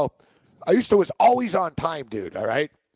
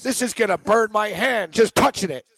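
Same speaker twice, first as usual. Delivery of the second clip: higher and higher, mock disgust at a fake watch; mock outrage, building; 8 semitones higher than usual. Manager mocking the fake watch.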